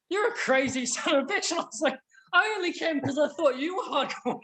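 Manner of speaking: high, giggly retold exchange; squeaky with amusement; laughing retold punchline. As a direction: quote both mates while cracking up.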